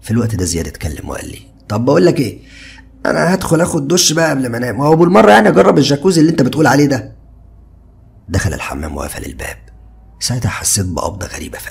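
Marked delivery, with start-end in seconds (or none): 1.7-7.1 higher, louder easygoing friend; carefree young man, higher and louder; Ziad's casual voice. A narrator's reading. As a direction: toss it off lightly like a carefree buddy. Narrator voices friend Ziad.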